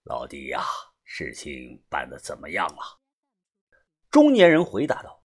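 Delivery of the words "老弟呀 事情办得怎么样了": low, soft gang boss asking; deep solemn voice. A narrator's reading. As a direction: ask gravely from deep in the throat. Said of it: "把头沉声地问道". (低沉沉稳地问)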